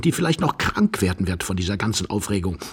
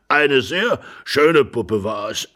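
put-on slow, relishing old-man voice; drawn-out elderly reminiscing; rambling old man; 2 semitones lower, slower than usual. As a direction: dwell ponderously on the memory. Old husband reminisces slowly.

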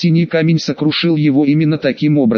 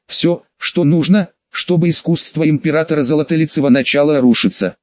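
plain reading voice

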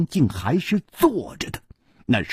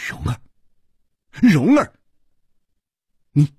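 shocked disbelief; 2 semitones lower than usual. A narrator's reading. Chu Liuxiang finds Rong'er here, stunned and halting. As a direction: falter between words in shock. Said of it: "楚留香大惊失色".

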